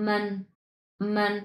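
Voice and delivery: মান said short and light with no tone; neutral tone. Shown as a demonstration. Teacher shows the toneless মান to students, light and short. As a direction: drop মান lightly, no pitch shape. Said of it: "এটা হচ্ছে neutral tone".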